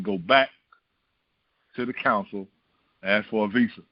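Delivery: God's voice, pitched low and calm; deep voice of God. Acted. Preacher voicing God to the believer, low and calm. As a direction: speak as God, low and steady.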